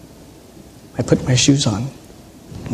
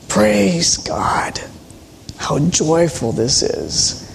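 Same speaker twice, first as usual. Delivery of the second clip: hushed aside; much slower than usual. Retold or imitated. An old chaplain at the back of the concert, whispering praise. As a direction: murmur it under the music like a moved old man.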